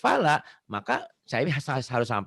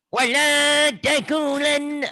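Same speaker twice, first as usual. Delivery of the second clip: very high, loud chant; wrong, too forceful; 12 semitones higher, 5 dB louder, slower than usual. Shown as a demonstration. Teacher mimicking what not to do, high forceful chant. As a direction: blare it out high, without any beauty. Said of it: "bukan gitu ya".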